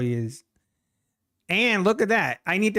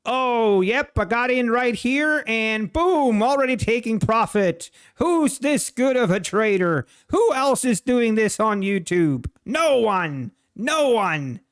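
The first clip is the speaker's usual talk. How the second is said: louder, higher hammed-up boast; over-the-top self-praise, pitched up and loud; mock bragging; 7 semitones higher, 5 dB louder, slower than usual. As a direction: crow like a show-off trader faking a perfect entry. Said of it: "and be like".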